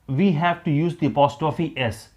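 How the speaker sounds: ordinary talk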